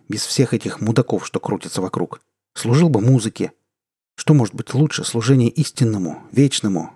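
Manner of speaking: usual speaking voice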